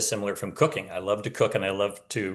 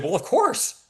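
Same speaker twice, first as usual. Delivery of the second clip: mock chorus of students; 12 semitones higher, 3 dB louder, slower than usual. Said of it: "And everybody said".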